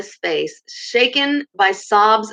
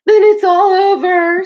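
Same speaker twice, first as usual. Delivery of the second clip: loud, much higher wail, slow; sobbing; 12 semitones higher, 8 dB louder than usual. A narrator's reading. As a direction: wail it out in despair. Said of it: "sobbed the Toad".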